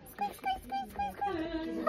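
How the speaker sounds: tiny squeaks like a toy rat; puppet rat squeaks. Pretend.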